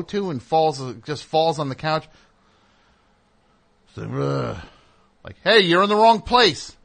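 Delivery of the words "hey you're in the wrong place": put-on loud, higher call to wake a drunk stranger. Speaker rousing the drunk on his couch, loud and higher. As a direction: raise the voice to rouse someone passed out.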